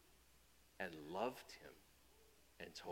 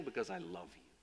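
soft, lower, gentle answer; tender reply; 6 semitones lower, 6 dB quieter than usual. Acted. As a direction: answer the child with patience. God answers child's why.

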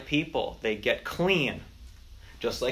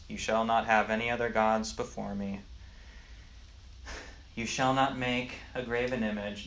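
flat, bored monotone reading; 2 semitones lower, 6 dB quieter than usual. Shown as a demonstration. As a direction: read it dully, no drama. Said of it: "read the commandments in a monotone voice".

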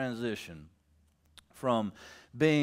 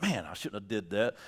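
low, softer rueful God; voicing God; 8 semitones lower, 4 dB quieter than usual. God regrets to himself, low and rueful. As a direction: mutter it as a regret.

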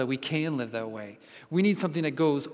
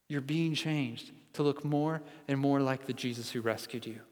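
usual speaking voice

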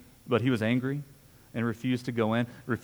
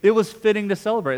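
higher and louder, savouring "It was fitting to celebrate"; joyful emphasis; 7 semitones higher, 6 dB louder than usual. Real, not acted. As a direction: proclaim the verse with real delight. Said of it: "I love that verse thirty-two".

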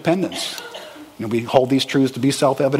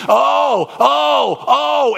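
high, loud mock exclamations of offence; hammed-up wounded groans; mock indignant oh; 10 semitones higher, 3 dB louder, much slower than usual. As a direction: cry out oh like someone whose territory was violated. Mock outrage of offended person.

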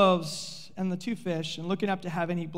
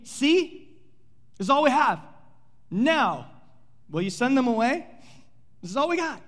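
insistent disciples; 5 semitones higher, 4 dB louder than usual. Acted. Disciples insisting to send crowd away.

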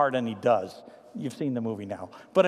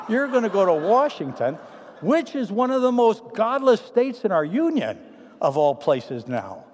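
louder, higher preaching emphasis; emphatic; 6 semitones higher, 6 dB louder than usual. Real, not acted. Pastor urging the church toward Washington, loud emphatic. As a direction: drive the point home.

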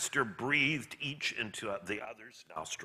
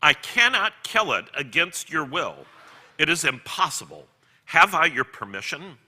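lightly voiced angel, emphatic and raised; firm, louder story voice for an angel; 5 semitones higher, 8 dB louder than usual. A narrator's reading. Angel asking the man's permission to kill the lizard, emphatic.

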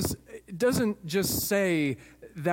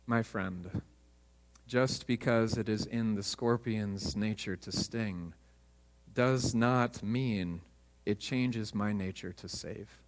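calmly; 7 semitones lower, 5 dB quieter than usual. A narrator's reading. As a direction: settle the stranger gently, no hurry. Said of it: "he said calmly".

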